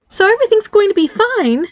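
anxiously high-pitched reassurance. A narrator's reading. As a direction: voice climbing far too high. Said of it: "pitch of her voice was getting high".

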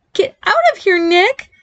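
high, squeaky outburst, little extra volume; shouted. A narrator's reading. Barry orders his little brother out, squeaky mild shout. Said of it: "Barry shouted louder than he meant to".

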